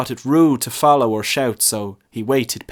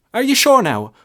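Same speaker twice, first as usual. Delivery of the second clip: high, louder worried question; anxious lad; 13 semitones higher, 7 dB louder than usual. A narrator's reading. Boy anxiously checking his confession is secret. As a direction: press for reassurance.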